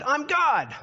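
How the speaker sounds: high, loud comic God voice; Richard Pryor quote. Retold or imitated. Preacher retelling Richard Pryor's stage bit, high and loud. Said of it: "Richard Pryor walked out and said".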